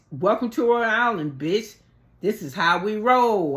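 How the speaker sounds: loud, swaggering retold challenge; imitated bravado, punched out loudly; mock taunt. Retold taunt before a fight.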